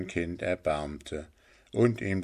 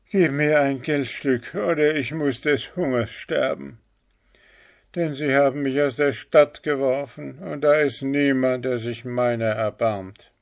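higher, pressing beggar's plea; begging leper; 6 semitones higher, 4 dB louder than usual. A narrator's reading. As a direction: call out for alms, pleading.

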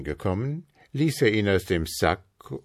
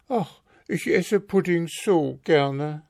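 wistful wife; 10 semitones higher than usual. A narrator's reading. Frau Marker longing.

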